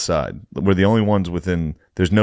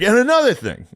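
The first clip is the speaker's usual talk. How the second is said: mock indignant comeback. Imagined comeback.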